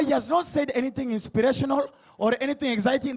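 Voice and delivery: ordinary delivery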